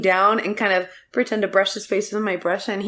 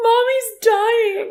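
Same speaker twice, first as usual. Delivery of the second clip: high, slow mock sob of a little girl; mock wailing child; 14 semitones higher, much slower than usual. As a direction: wail it like a kid crying. Daughter voiced wailing.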